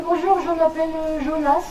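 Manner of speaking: put-on high small boy voice; little boy voice. Jonas introduces himself to the teacher, high little boy voice. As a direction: answer like a timid kid.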